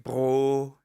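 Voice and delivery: "Bro" in a put-on Indian accent. Shown as a demonstration. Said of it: "I always imagine it in an Indian accent".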